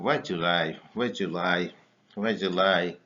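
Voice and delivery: Why July with did you blended into July; run together. Shown as a demonstration. Teacher drilling the blended form. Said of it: "So you come".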